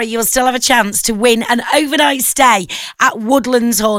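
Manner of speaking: normal talking voice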